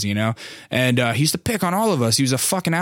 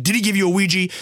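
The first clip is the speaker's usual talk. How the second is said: raised-pitch imitation of an insistent teacher; mock teacher questioning; 8 semitones higher, faster than usual. Gym teacher looking over at the victim, pressing.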